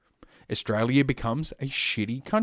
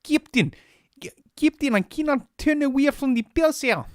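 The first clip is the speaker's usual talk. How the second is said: put-on much higher, louder Russian-accented Chekhov; Chekhov impression; 4 dB louder than usual. Streamer slipping into Chekhov's Russian accent. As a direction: squeak it out like a Star Trek Russian. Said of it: "I'm sounding like Chekhov".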